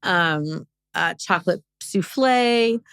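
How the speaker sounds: ordinary delivery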